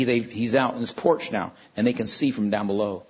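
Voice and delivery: ordinary talk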